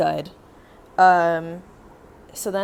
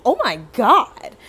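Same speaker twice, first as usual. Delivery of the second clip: loud, drawn-out exasperated shock; big slow comic disbelief; retold astonishment; 5 semitones higher, 10 dB louder, slower than usual. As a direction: act out being floored, stretching it slowly. Retold shock at girl taking apartment.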